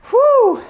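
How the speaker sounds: high, loud whoo of effort mid-set; breathless high whoop between reps; exertion whoo. Real, not acted. Exhale between workout reps.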